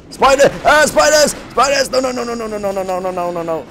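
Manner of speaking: high frightened shrieks fading to pleading; panicked screaming. Real, not acted. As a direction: scream in panic at the spiders, then beg. Panics at spiders in game.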